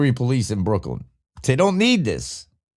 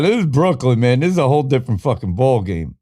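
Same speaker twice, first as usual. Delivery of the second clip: ordinary talk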